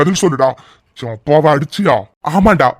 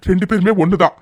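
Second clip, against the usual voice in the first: ordinary delivery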